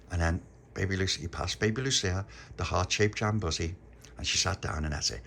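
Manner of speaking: ordinary delivery, nothing marked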